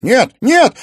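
loud, rising desperate cry; desperate shout. Acted. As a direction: scream no in despair. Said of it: "отчаянно крикнул Тимофей".

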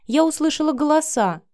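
put-on high-pitched young girl's voice; sleepy daughter. A narrator's reading. Cindy woken by the adults' voices, girlish and sleepy. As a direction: explain yourself in a high girlish voice. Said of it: "Заспанная Синди вышла в гостиную".

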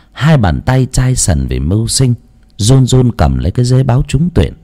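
ordinary delivery

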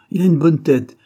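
loud, high-pitched scoff; mocking retort; sneering. A narrator's reading. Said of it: "reprit-il en ricanant".

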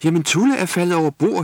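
high, loud panicked wail; wailing in fright. A narrator's reading. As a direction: wail it out, scared for Tulle. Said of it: "hylede Jule". (hylende i panik)

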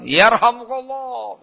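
high, drawn-out mock crowd chant; menirukan seruan menggema. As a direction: stretch it out like a whole troop echoing. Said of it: "Begitu, menggema". (menirukan seruan pasukan yang panjang bergema)

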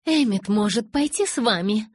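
indignant insistence. A narrator's reading. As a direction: push back hard against the plan. Rosalie objects angrily to plan. (возмущённо, повышенным тоном)

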